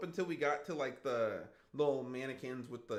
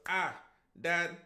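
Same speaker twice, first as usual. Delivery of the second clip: put-on higher, louder kid piping up; retold daughter; 4 semitones higher, 6 dB louder than usual. Daughter calling her dad after two minutes, higher and louder. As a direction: call out to dad like a child with a realization.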